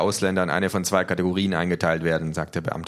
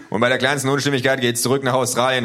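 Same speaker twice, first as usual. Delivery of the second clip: loud, higher threat; calling out; 6 semitones higher, 7 dB louder than usual. Acted. Official threatening deportation, loud and high. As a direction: threaten with raised voice. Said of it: "ruft der Mann".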